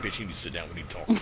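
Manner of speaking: soft, low sit-down warning; mock calm threat. Villain calmly tells the heroine to sit down, quiet. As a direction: tell her quietly to sit and talk.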